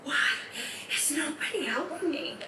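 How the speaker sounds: soft, bewildered inner plea; helpless pleading. Acted. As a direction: plead quietly, as if to yourself. Relives breakdown, silently begging for help.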